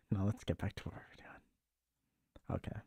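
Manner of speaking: slow hushed whisper. Real, not acted. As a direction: whisper it to yourself, slowly. Whispered return to topic.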